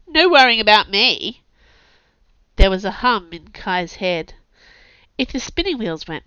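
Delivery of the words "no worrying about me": loud, sulky shout upward; yelling up. Acted. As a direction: call up, offended. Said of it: "Chase yelled up".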